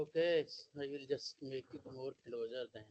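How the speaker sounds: faint, distant voice on a call; muffled and far from the mic; barely audible. Real, not acted. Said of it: "we are not able to hear you".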